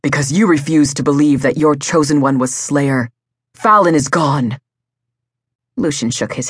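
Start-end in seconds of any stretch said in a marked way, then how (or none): 0.0-4.6 heated, accusing sneer. A narrator's reading. Wolf blaming his brother for the kidnapping, sneering. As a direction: throw the blame in his face. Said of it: "Rafe sneered in his brother's face".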